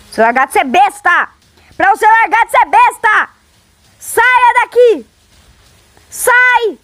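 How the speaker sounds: rising to a high shriek, slowing down; frustrated yelling. Real, not acted. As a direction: scream at the monster to go away. Yelling at game monster, frustrated.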